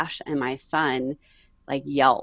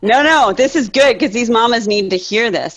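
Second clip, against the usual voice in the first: no, no laughed out, higher and louder; laughing reassurance; 6 semitones higher, 8 dB louder than usual. Real, not acted. Guest laughing as she reassures the host it is good. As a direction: wave the apology away with a laugh.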